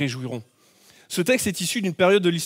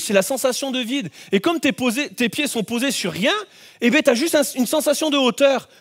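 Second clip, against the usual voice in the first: ordinary delivery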